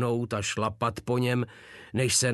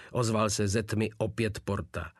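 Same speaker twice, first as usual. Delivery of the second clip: ordinary delivery, nothing marked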